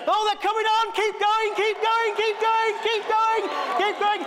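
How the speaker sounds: frantic cheering on. Real, not acted. Vicar cheering Bethany on as the lights come on, rising frenzied shout. Urging the pedalling girl on.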